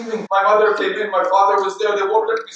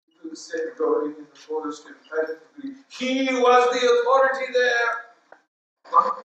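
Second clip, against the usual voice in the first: normal talking voice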